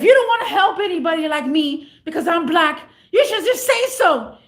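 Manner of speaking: imitating a loud, high-pitched outburst; going crazy. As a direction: rant it loudly as the outraged customer. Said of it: "he just started going crazy".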